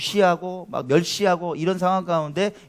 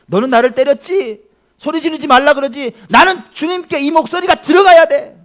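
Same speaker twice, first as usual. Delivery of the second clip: high, much louder defiant cry; defiant shout; 11 semitones higher, 13 dB louder than usual. Pretend. Bartimaeus voiced crying out to the Lord, loud defiant shout. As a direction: double the volume and defy them. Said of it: "볼륨을 두 배로 올려버리는 거예요".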